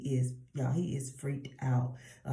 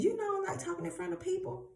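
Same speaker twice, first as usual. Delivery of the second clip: very high, quick mock protest; whiny excuse; faster than usual. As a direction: squeak out Moses's excuse in a rush. Moses's excuse to God, acted.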